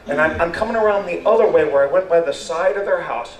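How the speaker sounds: normal talking voice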